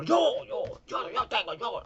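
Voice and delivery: a shrill-voiced eager man pushing forward; eager squeaky volunteer. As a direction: jump in first, high and eager.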